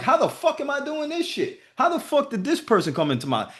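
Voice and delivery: high, incredulous retold question; amazed disbelief. Coach recalls doubting himself, amazed and incredulous. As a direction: wonder aloud how it happened. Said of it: "I'm like".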